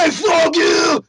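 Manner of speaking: hammed-up, high-pitched furious roar; Todd Howard going Hulk. Game developer raging at podcasters who criticised him, hammed-up furious shouting. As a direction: bellow it in a huge mock rage. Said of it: "he would just keep going".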